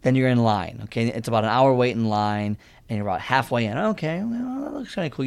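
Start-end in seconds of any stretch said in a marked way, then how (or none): 3.8-5.2 high, falsetto mock of his cocky self. Mocking his past self in line, high falsetto. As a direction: pitch way up, playing his naive self.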